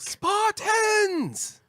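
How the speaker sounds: very high, far-carrying battle shout; rally the troops, screaming; battle cry. Acted. Joking battle cry about arrows.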